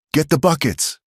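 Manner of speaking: high, urgent yell. A narrator's reading. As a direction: yell the order over the fire. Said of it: "Silas yelled".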